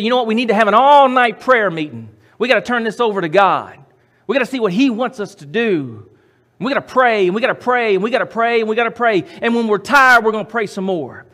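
loud, high, driving insistence; rally the church folks to prayer; urgent rallying. Acted.

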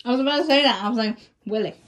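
talking while chewing; mouth full of cake. Real, not acted. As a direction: food at lips. Seen 1: Holds cake piece at mouth while talking; chewing likely, mouth partly hidden.

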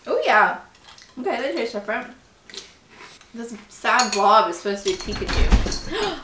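usual speaking voice

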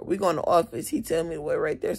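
ordinary talk, nothing marked

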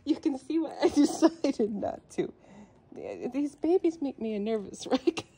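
loud laughing confession. Real, not acted. Laughing loudly to viewers about being nervous.

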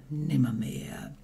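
low, toneless raven whisper; raven's reply. A narrator's reading. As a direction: breathe it out like a raven in the shadows. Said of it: "Sprach der Rabe". (tief geflüstertes Rabenwort)

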